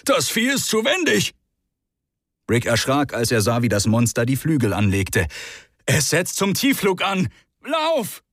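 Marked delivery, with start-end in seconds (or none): 0.1-1.3 cursing. Acted. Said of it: "fluchte Hubert". 5.9-8.2 rising high to a yelled run; urgent warning. Acted. Rick warns Hubert of the dive, urgent high shout.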